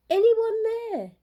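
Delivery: high, slow, loud call. A narrator's reading. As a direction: call far into the woods, slowly. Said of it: "she called out".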